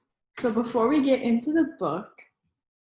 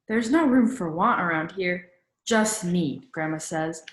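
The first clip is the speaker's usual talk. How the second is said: usual speaking voice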